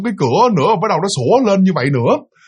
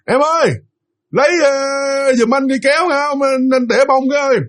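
retold yelling down; 8 semitones higher than usual. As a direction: imitate an annoyed second call from upstairs. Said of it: "mà anh la lớn lên".

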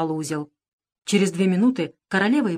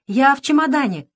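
higher, louder little squeak; 8 semitones higher, 5 dB louder than usual. A narrator's reading. Queen squeaks back to Rat from the case, higher. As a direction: pipe up from the suitcase. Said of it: "пискнула в ответ королева".

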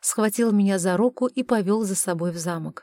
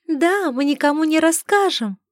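high tinkling mermaid voice, louder; a tiny crystal water sprite; тоненький хрустальный голосок; 8 semitones higher, 4 dB louder than usual. Acted. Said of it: "тоненький хрустальный голосок". (тоненький хрустальный голосок русалки)

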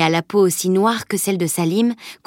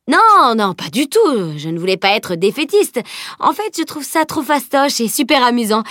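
put-on lively, higher-pitched eager boy; garçon enjoué; 5 semitones higher than usual. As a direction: protest eagerly as an excitable boy. (garçon enthousiaste qui proteste vivement)

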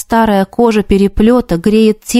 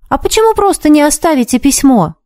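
put-on boy voice; 7 semitones higher than usual. A narrator's reading. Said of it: "не унимался мальчишка".

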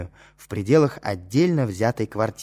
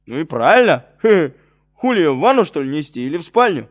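guffawing; 5 semitones higher, 7 dB louder than usual. Acted. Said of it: "заржал Колян".

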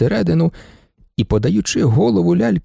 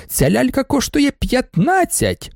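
high, drawn-out outraged baroness; scornful exclamation; 10 semitones higher, slower than usual. A narrator's reading. Baroness disputes the price, high and scornful. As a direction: exclaim it high, stretching the number.

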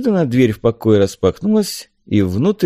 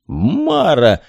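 raised, drawn-out cry of the name; astonished exclamation; 5 semitones higher than usual. A narrator's reading. Helmsman recognising Mara, astonished. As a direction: exclaim in surprise. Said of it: "воскликнул он".

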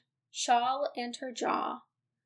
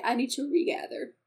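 usual speaking voice

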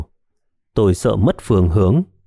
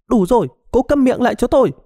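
much higher, fast furious shriek; shrieking scream; 13 semitones higher, faster than usual. A narrator's reading. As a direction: scream to shut her up. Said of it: "Lý Cầm gào lên". (gào lên giận dữ, cao và nhanh)

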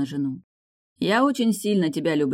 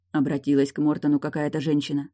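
usual speaking voice

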